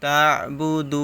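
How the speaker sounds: short "তা বু", then a sustained "দু"; three-alif madd. Shown as a demonstration. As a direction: hold the u of "দু" very long. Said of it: "এখানে তিন আলিফ টান দিতে হবে". ("দু"-তে তিন আলিফ টান)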